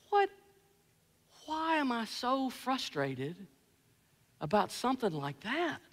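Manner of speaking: very soft, slow reflection; quiet, halting soul-searching; hushed self-questioning. Retold or imitated. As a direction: wonder to yourself, barely voiced. Stopped, questioning himself after outburst.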